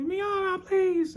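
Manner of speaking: very high squeaky plea; begging Ippo. Retold or imitated. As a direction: whine it way up like a desperate kid. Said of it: "He's like".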